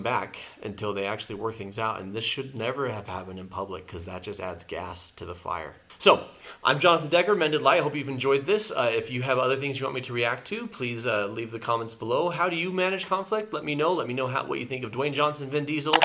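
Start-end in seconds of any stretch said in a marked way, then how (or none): 6.0-15.9 higher, louder host sign-off; energetic YouTube outro; upbeat outro. Acted. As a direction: wrap up the video like a cheerful host. Channel sign-off.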